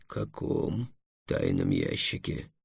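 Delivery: very low, slow, soft question; deep dragon, troubled; uneasy dragon. A narrator's reading. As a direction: ask slowly in a deep, wary dragon voice. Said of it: "заволновался дракон".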